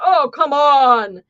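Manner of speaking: slow, loud, exasperated bang on the table; big booming objection. Retold or imitated. State attorney scoffs at the jury, loud and booming. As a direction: boom it out like a bullying lawyer. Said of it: "he had a big booming voice".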